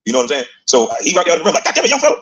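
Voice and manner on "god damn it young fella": quoting MJ. Retold or imitated. MJ quoted under the rim.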